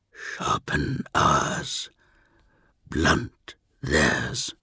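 very slow, low whisper; dying whisper. Acted. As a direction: breathe the words out barely audible. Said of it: "voice shrank to a whisper".

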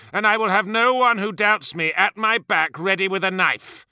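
high, rising angry threat; furious ultimatum; awful threat. A narrator's reading. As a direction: declare you will tolerate no traitor. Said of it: "sensed the awful threat in Fulgrim's words".

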